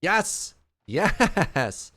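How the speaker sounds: loud excited yes; triumphant cheer. Real, not acted. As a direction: cheer the win.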